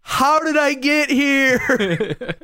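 high, loud, slow mock yell; drawn-out holler of comic bewilderment; dazed, confused friend. Injured friend waking in the hole, confused yelling.

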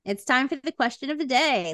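ordinary talk, nothing marked

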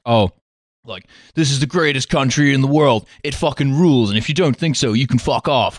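lightly mocking patriotic boast; mock American patriotism. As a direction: brag about your country in mild parody. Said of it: "American patriotism is like".

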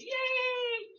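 high bright yay; praise cheer. Shown as a demonstration. Therapist showing parents how to praise a child. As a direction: cheer the toddler on. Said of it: "we're doing the praise".

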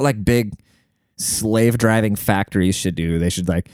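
ordinary talk

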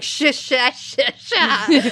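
stuck sh-sh syllables like a machine restarting; glitching mimicry. Friend mimics her turned back on, sputtering sh sounds. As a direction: sputter repeated shush sounds, ending in a laugh. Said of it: "You're like".

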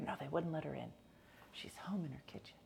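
softly whispered aside; hushed confiding whisper. Real, not acted. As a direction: drop to a whisper for the joke.

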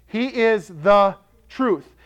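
"the" weighted, said slowly; slow and firm, lean on "the"; stress on the. Shown as a demonstration. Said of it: "That's a declarative statement".

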